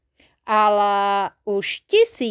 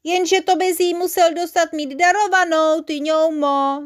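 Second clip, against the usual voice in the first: high, raised storybook call; taunting call, dragged out at the end; 6 semitones higher, 5 dB louder than usual. A narrator's reading. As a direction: call out from inside the tent. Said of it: "zavolala koukalka z týpí".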